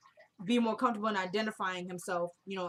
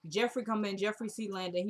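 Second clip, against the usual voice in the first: ordinary delivery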